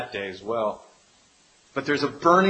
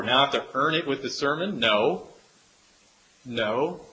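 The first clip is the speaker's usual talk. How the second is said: usual speaking voice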